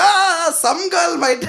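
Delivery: slow, high mock dude; mock dumb guy. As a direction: drag it out like a clueless guy. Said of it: "They're like".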